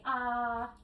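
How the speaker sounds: drawn-out open-mouthed ahh; saying ahh. Shown as a demonstration. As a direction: show the patient a long ahh. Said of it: "open your mouth and say".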